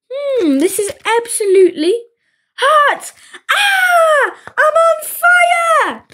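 high, rising to a wailing yell; building panic. A narrator's reading. Willy biting the hot cookie, panicked yelling. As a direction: yell out as the burn hits.

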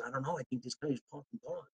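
very soft, offhand aside; hushed unsure answer. Acted. Party guest answers who's next in command, hushed. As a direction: drop right down for an acted reply.

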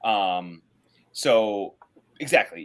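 normal talking voice

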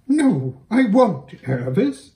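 high, loud defiant refusal; defiant mare. Acted. Hwin refusing Aravis, high and defiant. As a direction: stand your ground. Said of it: "putting her ears back".

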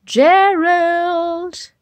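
high, loud cheerful call of a name; character calling out. Acted. Storybook friend calling Gerald, high and loud. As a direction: call to your friend from across the room.

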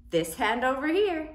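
ordinary talk

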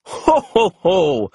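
jolly, booming ho ho ho; Santa's ho ho ho. A narrator's reading. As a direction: laugh like Santa. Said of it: "Santa laughed".

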